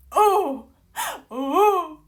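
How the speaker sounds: high, loud delighted cry; laughing whoop of delight; swooning "oh". Real, not acted. Reader reacting to Cassian's looks, delighted cry.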